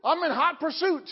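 normal talking voice

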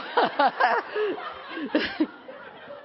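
bright high giggle; high laugh. Real, not acted. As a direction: laugh at yourself. Laugh at own story.

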